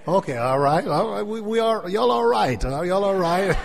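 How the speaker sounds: high, loud, rising call to the crowd; rousing call-out. Real, not acted. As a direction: fire up the congregation. Rousing the congregation.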